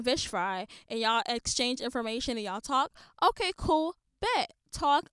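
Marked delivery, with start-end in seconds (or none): 3.2-5.1 upbeat approval. Real, not acted. Approving people who exchange info.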